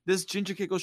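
ordinary talk

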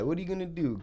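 ordinary talk, nothing marked

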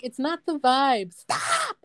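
slow, stretched mock protest, leaping high on Stop; mimicked viral-video catchphrase; imitating TikTok woman. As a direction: drag out the phrase, then pitch Stop way up. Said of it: "this chick, she's like".

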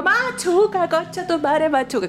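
high mock holler, falling toward the end; retold yelling. Defying a friend who says calm down, imitated high yell. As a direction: reenact a high, insolent heckle. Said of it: "Y le gritaba".

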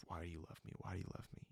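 ordinary delivery

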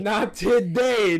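slow, high, loud proclamation; mock divine shout. Hades spares the runaway, slow mock shout. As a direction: boom it like a god. Echoing god's line, shouted.

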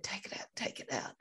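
fast, breathless, voiceless plea; panicked plea. Retold or imitated. Retelling the needle shock to the host, breathless panicked plea. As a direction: gasp it out as if you can't breathe.

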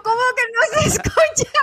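high, loud, incredulous squeal; high laughing disbelief; shocked disbelief. Retold or imitated. Her shocked reply, retold.

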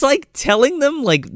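usual speaking voice

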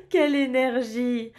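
laughing, delighted mother. Acted. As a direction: laugh through it, amused. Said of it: "maman éclate de rire". (en éclatant de rire)

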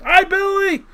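high, loud, drawn-out crowd holler; crowd greeting. Retold or imitated. Whole arena greets Billy, imitated crowd shout. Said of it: "then the whole arena goes".

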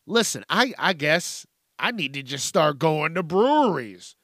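usual speaking voice

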